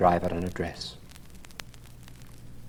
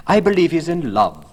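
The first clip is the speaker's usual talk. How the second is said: put-on higher, louder fussy old lady exclaiming; excited old lady cry; 10 semitones higher, 8 dB louder than usual. A narrator's reading. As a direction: cry it out like a delighted gossiping hostess. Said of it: "cried Lady Narborough".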